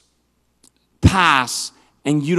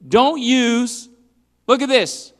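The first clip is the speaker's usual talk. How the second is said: ordinary delivery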